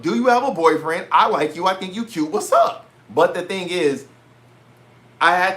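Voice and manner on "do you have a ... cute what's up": bold pickup line. Pretend. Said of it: "I'm going in there talking about".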